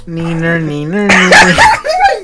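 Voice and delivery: slow, drawn-out neener; playground taunt. Shown as a demonstration. Showing friends how the jeer sounds, playground taunt. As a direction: stretch the last word into a taunt. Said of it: "How does it sound like".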